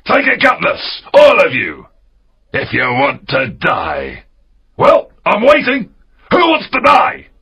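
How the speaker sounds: high, loud pirate captain's dare; shouted challenge. Acted. Silver dares his crew to take cutlasses, shouting. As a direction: shout them down, daring anyone to fight.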